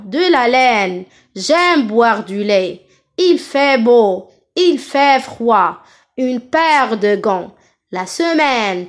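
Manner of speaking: slow, stretched è in "laine", "lait", "fait"; open è. Shown as a demonstration. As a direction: open wide on the ai of "semaine". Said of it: "Toujours, j'ouvre ma bouche". (« ai » prononcé è ouvert, lentement)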